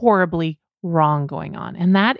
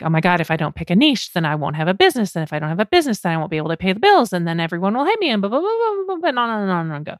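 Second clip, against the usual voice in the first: mock freak-out; much faster than usual. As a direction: race through worries, pitch soaring into blah-blahs. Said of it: "your brain's freaking out like".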